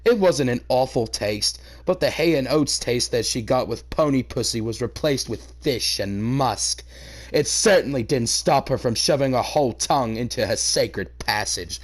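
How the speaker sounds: usual speaking voice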